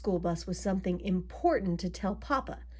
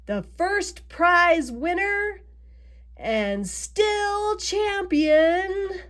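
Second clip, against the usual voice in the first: high, loud, drawn-out announcement; contest announcer; 9 semitones higher, 5 dB louder, much slower than usual. A narrator's reading. Judges announce the contest winner, ringing announcer voice. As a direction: stretch it like a ring announcer. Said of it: "they made their announcement".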